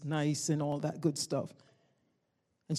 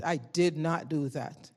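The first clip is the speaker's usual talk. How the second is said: slow, weighted "I did not do that"; firm denial; slower than usual. Real, not acted. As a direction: space out every word firmly. Repeats denial slowly.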